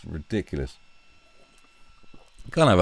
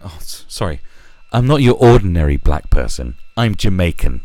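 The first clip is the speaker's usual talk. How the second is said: mock quote; 10 dB louder than usual. Mock quote of a Jamaican person.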